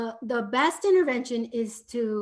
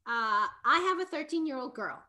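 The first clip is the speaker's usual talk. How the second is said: usual speaking voice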